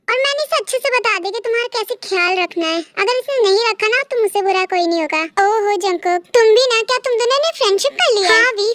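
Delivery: usual speaking voice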